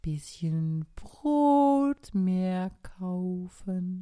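slow, loud and lazy snail; sluggish snail. Acted. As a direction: drag it out, ignoring the hurry. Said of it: "mit ihrer gewohnten Trägheit".

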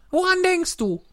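high, squeaky mouse voice, sulking; a small hurt mouse complaining; offended little mouse. A narrator's reading. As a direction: mouse grumbling that nobody listens to her tale. Mouse questions Alice.

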